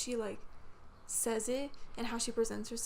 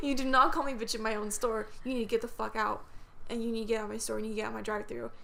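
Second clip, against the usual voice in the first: ordinary delivery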